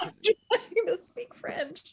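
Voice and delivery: high, slow, laughing through the words. Retold or imitated. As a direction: laugh while pleading. Laughing retold plea.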